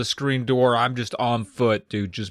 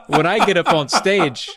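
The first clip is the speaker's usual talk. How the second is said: ordinary delivery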